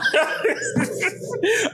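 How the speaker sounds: high, airless real laughter. Real, not acted. Laughs at own story.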